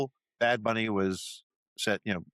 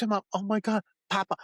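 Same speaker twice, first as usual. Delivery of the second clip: much higher, gushing parent voice; thrilled parent; 12 semitones higher than usual. Retold or imitated. Sketch parent gushes over the baby in a much higher voice. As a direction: gush over the talking baby. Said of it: "He goes".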